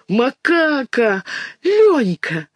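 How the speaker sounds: high, delighted calls of names; joyful exclamations. A narrator's reading. Children greet Lyonka bursting in, joyful high cries. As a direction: cry out in happy surprise. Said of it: "радостно вскрикнула Мышка".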